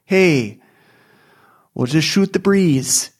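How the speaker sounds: breezy North Dakota host; North Dakota accent. Pretend. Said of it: "I have, like, a North Dakota".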